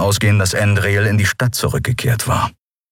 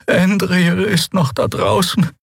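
high and slow, plaintive; worried high creature voice; 12 semitones higher, slower than usual. Acted. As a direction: plead it with a wail in it. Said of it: "klagenden Laut aus ihrem Horn".

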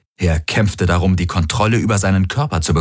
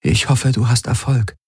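higher voice, gentle worry; worried; 6 semitones higher than usual. A narrator's reading. Ylana sees off the suffering Galen, worried. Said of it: "Ylana nickte besorgt".

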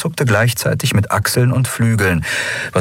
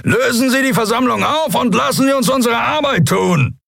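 high, full-throated roar. Acted. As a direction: bellow the order at the crowd. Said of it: "brüllte er".

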